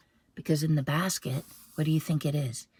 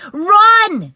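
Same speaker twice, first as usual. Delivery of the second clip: much higher, very loud; urgent mom shout; 21 dB louder than usual. Acted. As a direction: yell run. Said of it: "shouted Mom".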